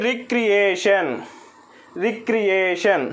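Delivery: Recreation said very slowly, syllable by syllable; slow syllable demo. Shown as a demonstration. Teacher showing students the syllables, very slowly. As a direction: stretch recreation out so each syllable is heard. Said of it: "next చూడండి".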